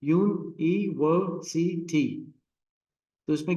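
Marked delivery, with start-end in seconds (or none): 0.0-2.1 university in five separate beats; very slow u-ni-ver-si-ty; syllable by syllable. Shown as a demonstration. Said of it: "syllable at a time".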